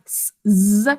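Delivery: "s, z" as a hiss, then a buzz; "s, z" with the buzz held; separate sounds. Shown as a demonstration. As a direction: isolate each sound in "s, z". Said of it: "saying its second sound".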